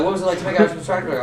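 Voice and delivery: fast eager question; mock creepy fan, fast; eager creeper. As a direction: rush the question out. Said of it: "they're like".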